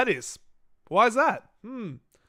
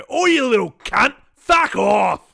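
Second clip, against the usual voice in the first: louder, higher mock snarl at a kid; mock snapping; 4 semitones higher, 7 dB louder, slower than usual. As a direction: snap at the brat, half joking. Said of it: "turn around and go".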